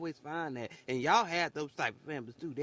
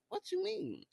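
mock disbelief; 4 dB quieter than usual. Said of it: "And I'm like".

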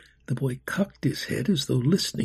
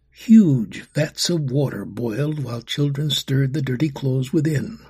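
ordinary delivery

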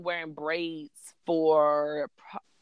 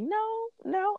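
put-on very high, small child's no; daughter thinking hard; 4 dB quieter than usual. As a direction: imitate a child pondering, high and soft. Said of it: "she's thinking hard".

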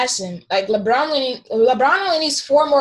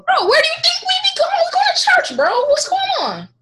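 indignant outburst; 4 dB louder than usual. Real, not acted. Indignant over church question.

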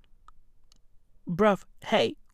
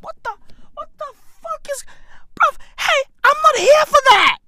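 squeaky-high disbelief building to a shout; incredulous protest; 3 dB louder, faster than usual. Retold or imitated. Patient retelling his reaction to the doctor, incredulous high protest. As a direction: start high and stunned, burst into loud protest.

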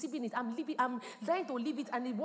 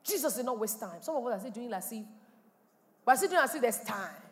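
ordinary talk

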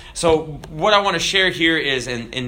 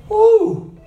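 loud, high "woo"; preacher's whoop; 7 dB louder than usual. Real, not acted. Preacher excited about addressing devils, loud whoop. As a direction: let out a sudden high whoop.

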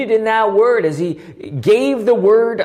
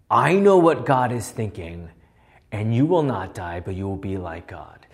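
low-pitched devil, dropping softer; devil tempting Eve; 11 semitones lower, 4 dB quieter than usual. Acted. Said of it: "as if the devil is saying".

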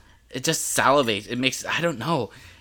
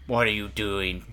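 jelly bean voice; 5 semitones lower, 5 dB quieter than usual. Acted. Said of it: "the jelly bean says".

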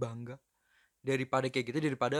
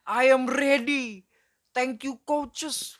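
high, slow, stretched-out parody; mock fighter hype; 7 semitones higher, 4 dB louder, much slower than usual. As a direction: send up an over-keen fighter, high and drawn out. Parody of eager fighter's posts.

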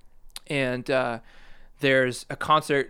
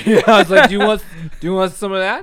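loud laughing retelling; 7 semitones higher, 12 dB louder, faster than usual. Real, not acted. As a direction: crack up while asking.

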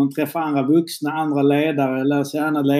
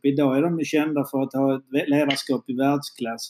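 usual speaking voice

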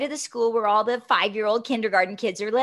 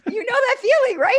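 laughing; 10 semitones higher, 5 dB louder, faster than usual. Real, not acted. Shared laugh over memory.

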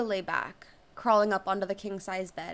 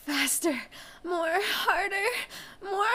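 breathless urging; 10 semitones higher than usual. Acted. Urging during climax.

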